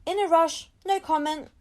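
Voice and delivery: brusque brush-off. Acted. Mum fends off journalists at door, brusque. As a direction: wave off the press on the run.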